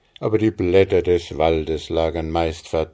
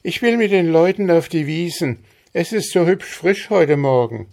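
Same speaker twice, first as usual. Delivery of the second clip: put-on higher girlish voice, eager; young girl Maren; 11 semitones higher, 5 dB louder than usual. A narrator's reading. Maren tells her father her plan, higher girlish voice. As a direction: sound keen to go out to the meadows.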